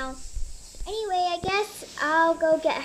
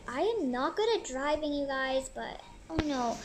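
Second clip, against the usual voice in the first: usual speaking voice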